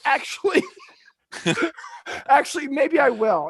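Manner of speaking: high mock second thoughts; reconsidering Aurora. Aurora going back on her refusal, high mock wavering. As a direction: play the sudden change of heart, laughing midway. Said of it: "And she goes".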